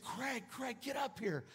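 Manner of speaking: high-pitched but soft mock call; imitated calling out. Wife calls husband upstairs, high softened imitated call. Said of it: "my wife comes calling from upstairs".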